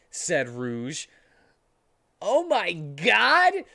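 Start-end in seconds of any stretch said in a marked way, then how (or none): 2.2-3.7 slow, high, loud oh my god; shocked gasp. Acted. Sonic sees pregnant Rouge, slow shocked exclamation. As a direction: drag it out stunned.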